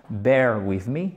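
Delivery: "Bear with me" said slowly, each word spaced; slow English phrase. Shown as a demonstration. Teacher asks students to stay with him, slow English. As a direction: ease the English out slowly for the class. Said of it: "restez avec moi".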